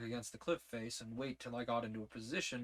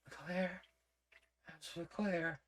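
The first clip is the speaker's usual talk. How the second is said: higher, dazed murmur repeating his name; 5 semitones higher, much slower than usual. Acted. Injured Lizzie lying in the hold, murmuring dazedly. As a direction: repeat it half-conscious, higher. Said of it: "I hear my own voice repeating".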